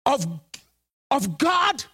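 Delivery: stumbling high over the words as if they won't come out; sarcastic stutter on mother of God; mock stammer. Shown as a demonstration. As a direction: act like the phrase sticks in your mouth. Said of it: "Does that even fit in your mouth?".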